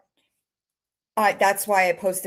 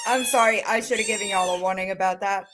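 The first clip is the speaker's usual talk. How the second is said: ordinary talk, nothing marked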